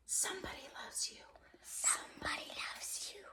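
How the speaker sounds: breathy whispered chant, hushed and quick. A narrator's reading. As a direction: murmur it like a secret from the cloth. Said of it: "the dust cloth seemed to whisper".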